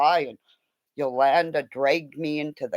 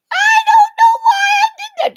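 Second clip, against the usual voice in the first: high, loud tearful cry; crying wail; 6 dB louder than usual. Retold or imitated. Telling Carmen why she did it, crying wail. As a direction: wail it out through tears. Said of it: "I went".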